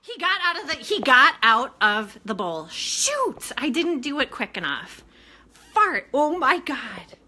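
loud, high and flustered; crying out in dismay; alarmed outburst. Real, not acted. Woman watching mouse get out of bowl, loud alarm.

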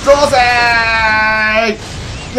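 drawn-out battle yell; screaming attack name. Real, not acted. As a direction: stretch the war cry. Attack in the game.

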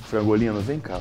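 ordinary talk, nothing marked

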